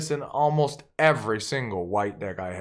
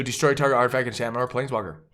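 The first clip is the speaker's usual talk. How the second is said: ordinary delivery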